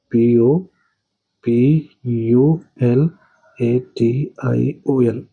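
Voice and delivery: slow letter-by-letter spelling, पी ओ पी यू एल ए टी आई ओ एन; teacher dictating पी ओ पी यू one letter at a time; spelling aloud. Shown as a demonstration. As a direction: spell it out slowly, each letter set apart. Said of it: "ऐसे आपने लिखा".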